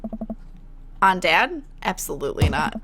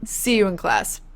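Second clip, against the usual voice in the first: ordinary delivery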